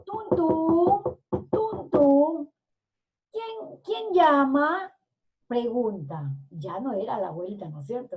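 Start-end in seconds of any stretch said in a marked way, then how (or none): 0.0-2.5 high, hollow spoken knocks; two pairs of voiced knocks, drawn out; imitated knocking. Girl knocks at the door, imitated knocking. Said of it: "unos golpes". 3.3-4.9 high, slow put-on granny voice; forced grandmother voice. A narrator's reading. Wolf poses as grandmother, forced grandmother voice. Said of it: "forzando la voz como si fuera la abuelita".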